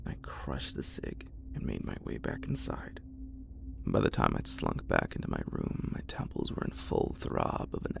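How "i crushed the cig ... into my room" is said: plain reading voice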